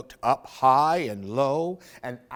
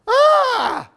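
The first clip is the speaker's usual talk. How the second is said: loud, much higher shriek; startled bear scream; 14 dB louder than usual. Acted. Albert screams at the owl, loud high shriek. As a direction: scream back in fright. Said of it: "screamed Albert".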